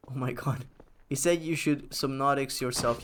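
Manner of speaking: usual speaking voice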